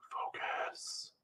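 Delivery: a breathy whispered focus. Real, not acted. Streamer telling themself to focus, whispered. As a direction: whisper the word to yourself.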